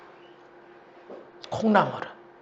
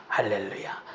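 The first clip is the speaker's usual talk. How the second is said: ordinary talk, nothing marked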